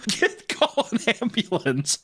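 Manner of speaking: high, slow pretend alarm; mock urgent call. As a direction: call for help in hammed-up panic.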